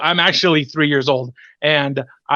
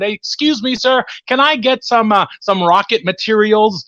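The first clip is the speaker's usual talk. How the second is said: higher-pitched, tentative ask; polite customer request; 6 semitones higher than usual. Retold or imitated. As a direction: imitate yourself politely flagging down a store clerk. Acts out asking a store clerk.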